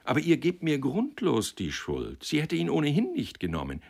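earnest, emphatic self-justification; protesting, mildly heated rebuttal; defensive young man. Acted.

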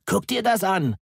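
high excited yell. A narrator's reading. Father yelling across the garden for his daughter to come look, high and excited. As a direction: shout it across the table. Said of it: "brüllte Ronny".

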